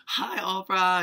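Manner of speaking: high, loud imitation of a star's hello; loud gushing greeting. Sofia Vergara walking over to Oprah, loud gushing hello. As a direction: call out an effusive mock greeting.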